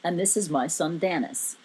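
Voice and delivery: deep, dignified father figure; Morgan Freeman-like. A narrator's reading. Giant introducing his son, deep and dignified. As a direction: present him in a low, stately voice. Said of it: "still sounding all Morgan Freeman-like".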